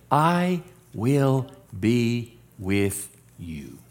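slow, soft and quiet, every word held; gentle divine promise. Acted. Pastor voicing God's promise to fearful Gideon, slow and soft. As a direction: lay it down tenderly like a promise.

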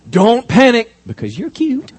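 high, loud, sing-song mock reading; mock the wrong reading of the verse; mock wrong version.

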